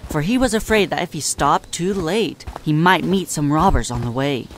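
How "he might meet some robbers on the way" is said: ordinary delivery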